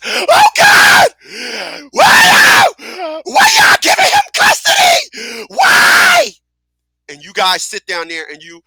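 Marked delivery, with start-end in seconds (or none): 0.0-6.3 screeching, sobbing wail, much higher and louder; mock hysterical wailing. Mocking the woman wailing to the judge, shrieking and sobbing. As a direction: ham up a woman crying out in court. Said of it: "she starts".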